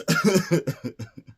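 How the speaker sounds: loud high burst of laughter; laughing. Real, not acted. Vlogger laughing at the fainting mom. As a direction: crack up at your own story.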